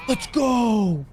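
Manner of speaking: high, drawn-out gamer cheer; elated cheer. Real, not acted. Gamer finds the prize untaken, high elated cheer. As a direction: cry it out high, thrilled. Celebrates that nobody took it.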